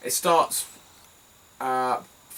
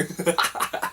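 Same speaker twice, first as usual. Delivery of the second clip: acted-out cackle of helpless laughter; own laugh re-enacted; 4 semitones higher, 4 dB quieter than usual. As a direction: laugh your head off, in imitation. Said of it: "You know what my laugh is like".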